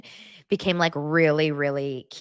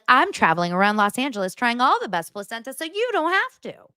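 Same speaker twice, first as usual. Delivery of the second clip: higher, bright presenter cadence; a mock food-vlogger voice, higher; mock food influencer; 7 semitones higher than usual. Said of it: "She's like".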